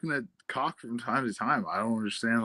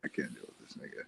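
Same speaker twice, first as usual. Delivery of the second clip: whispered under the breath, much softer; exasperated whisper; 14 semitones lower, 16 dB quieter, faster than usual. Real, not acted. Whispering to the co-host after a guest's odd remark, exasperated. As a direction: mutter it aside, barely voiced, fed up.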